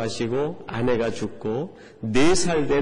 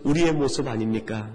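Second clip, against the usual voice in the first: usual speaking voice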